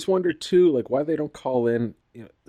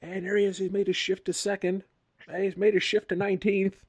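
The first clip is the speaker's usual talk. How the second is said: higher-pitched play-by-play, hammed up; mock race commentator; 8 semitones higher than usual. Mock commentator narrating a stretched-out drag race video, play-by-play. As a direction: pretend to call each gear change live.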